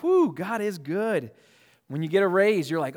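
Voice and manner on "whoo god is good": mock cheer. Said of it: "man, you're like".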